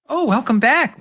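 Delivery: high, bright greeter voice; front desk woman. Acted. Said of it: "She looks up, says".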